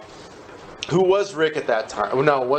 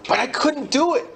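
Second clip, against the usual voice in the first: high desperate; 12 semitones higher than usual. Retold or imitated. Desperate recollection.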